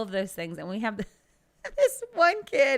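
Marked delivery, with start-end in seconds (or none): 1.8-2.8 slow, higher, giggling; laughing. Real, not acted. Telling coworker the story while laughing. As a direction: laugh through the words.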